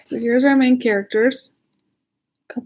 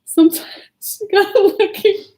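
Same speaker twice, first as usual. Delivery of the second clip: laughing; 9 semitones higher, 4 dB louder than usual. Real, not acted. Laughter breaks into reading.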